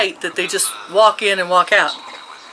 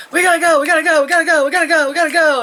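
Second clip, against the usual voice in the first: frantic ex-son-in-law; 9 semitones higher, much faster than usual. Retold or imitated. Ex-son-in-law hurrying everyone out, frantic high chant. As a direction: rattle it off like a man in a rush. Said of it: "the ex-son-in-law is like".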